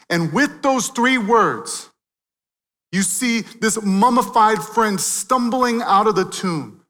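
loud, high-pitched climactic preaching; preaching at full force. Acted. Preacher proclaiming Jesus's victory over death, full force. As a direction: build the crowd to the triumph.

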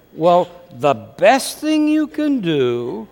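slow, drawn-out words; careful, stalling answer. Acted. Marriage teacher answering a wife, slow and careful. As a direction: stretch it out as you ease into advice.

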